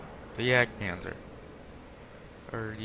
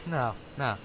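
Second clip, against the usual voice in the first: ordinary delivery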